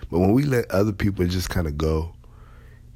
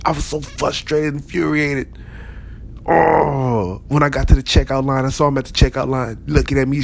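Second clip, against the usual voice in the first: frustrated shopper rant; 6 semitones higher than usual. Retold or imitated. Shopper ranting to a friend about the checkout, hammed-up. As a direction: rant about the smirking chip thief.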